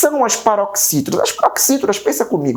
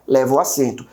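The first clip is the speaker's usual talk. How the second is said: ordinary talk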